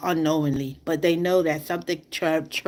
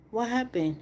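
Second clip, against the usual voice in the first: usual speaking voice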